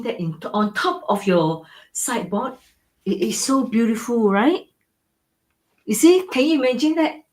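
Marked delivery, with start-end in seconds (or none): 3.0-7.2 high, bright-eyed question pitched way up; excited gushing. Real, not acted. Seller gushing to viewers about the painting, excited. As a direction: sell the dream, thrilled.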